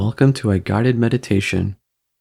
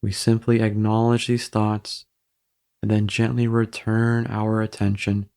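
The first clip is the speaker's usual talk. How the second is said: even, unhurried meditation voice with long pauses; guided meditation. Real, not acted. Guiding listeners through breathing to ease embarrassment, calm meditation voice. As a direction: guide them gently into stillness, evenly and calmly.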